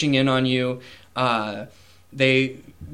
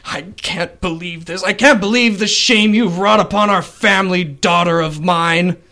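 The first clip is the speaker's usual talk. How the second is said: higher, louder lament of shame; grieving father; 5 semitones higher, 5 dB louder than usual. Acted. Father to his daughter, anguished and ashamed. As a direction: wail at the disgrace. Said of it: "your father is screaming and wailing".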